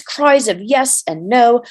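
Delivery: ordinary delivery, nothing marked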